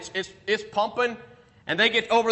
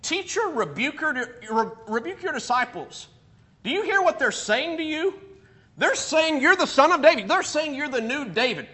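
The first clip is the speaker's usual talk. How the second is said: high, challenging voice of scandalised religious leaders; mock-indignant Pharisee protest pitched well above normal; 7 semitones higher than usual. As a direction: needle him with pointed, high-pitched outrage. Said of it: "They say".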